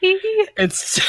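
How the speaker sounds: high cracking laughter; laughing. Real, not acted. GM laughs at Batman joke.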